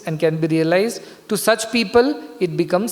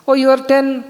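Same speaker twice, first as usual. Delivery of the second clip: raised, high-pitched questioning exclamation; surprised question; 7 semitones higher, 4 dB louder than usual. Acted. Guru checks the crying students' count, surprised question.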